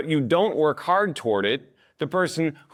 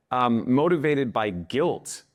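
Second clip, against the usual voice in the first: ordinary talk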